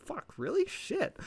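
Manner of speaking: high, squeaked disbelief; mock squeaky dismay. Vlogger mimicking Cody's reaction, high and squeaky. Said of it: "Cody's like".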